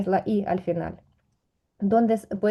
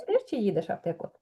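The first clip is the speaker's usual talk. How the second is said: fast and softer, йдеш run together with чи; relaxed, fast; 6 dB quieter, faster than usual. Shown as a demonstration. Teacher saying it the relaxed everyday way. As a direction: toss it off casually. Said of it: "y ahora relajadamente".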